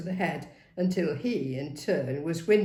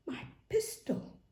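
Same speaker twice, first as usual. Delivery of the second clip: higher whispered thought; whispered realisation; 13 semitones higher, 7 dB quieter than usual. Acted. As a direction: breathe it out, realising. Inner realisation.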